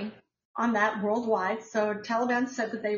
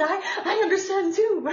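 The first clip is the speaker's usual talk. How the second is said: quoting her through a laugh, pitched up; 9 semitones higher, 5 dB louder than usual. Retold or imitated. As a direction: giggle the words as the friend. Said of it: "She said".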